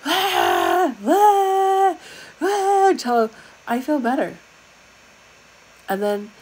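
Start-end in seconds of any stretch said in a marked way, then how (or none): 0.1-2.9 high, loud drawn-out screams; screaming release. Shown as a demonstration. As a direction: let it rip like screaming in the truck. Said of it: "I just start screaming".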